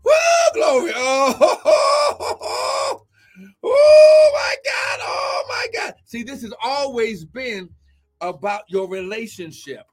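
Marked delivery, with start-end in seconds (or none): 0.0-5.9 much higher and louder, slow and laughing with joy; ecstatic laughing shout. Real, not acted. Preacher overcome with joy about God's presence, ecstatic laughing shout. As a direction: let the joy overwhelm you until you laugh.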